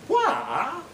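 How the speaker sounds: "Why" stretched high with a dip; syllables added. Shown as a demonstration. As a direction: draw "Why" out into three syllables. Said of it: "sound like three syllables".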